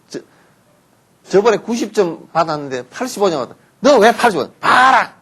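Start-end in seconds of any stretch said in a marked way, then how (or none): 3.8-5.2 put-on sharp, loud, high scolding of a child; mother yelling over a test score. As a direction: snap at the kid over the score.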